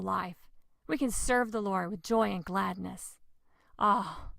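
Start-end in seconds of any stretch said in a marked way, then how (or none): none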